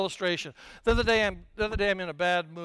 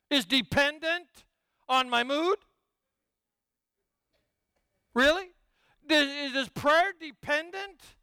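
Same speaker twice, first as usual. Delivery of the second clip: mock indignant questions; 6 semitones higher than usual. Indignant questioning of own assumption.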